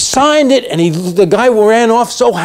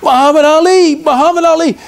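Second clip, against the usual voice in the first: higher, eager fan calls; excited fans; 10 semitones higher than usual. Retold or imitated. Fans at the airport begging Ali for an autograph, excited. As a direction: call out like thrilled autograph hunters. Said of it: "people are coming up to him and saying".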